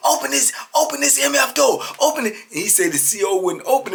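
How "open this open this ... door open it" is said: high, loud urgent demand; raised, insistent retold order; retold angry demand. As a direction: act out a man yelling to be let through. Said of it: "telling the, uh, C O in the booth to open the door".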